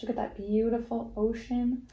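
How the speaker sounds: ordinary delivery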